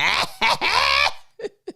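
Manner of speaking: high cackling laugh; ugly laugh. Shown as a demonstration. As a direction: let the cackle out. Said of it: "It is gonna be like".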